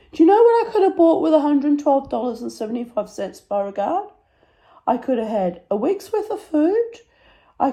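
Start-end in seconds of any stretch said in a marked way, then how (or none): 0.1-4.1 high-pitched exasperated scolding; higher, hammed-up indignation; mock indignant. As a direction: scold the pet in playful outrage. Scolds dog over the vet bill.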